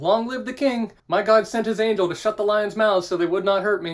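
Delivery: hammed-up jubilant Daniel; joyful reply. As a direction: call up to the king with glee. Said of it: "And Daniel answered".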